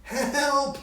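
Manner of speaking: high, loud frightened yelp; cry for help. Acted. Scrooge terrified as the ghost's jaw drops, loud high cry. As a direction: shout for help in horror. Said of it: "he cried".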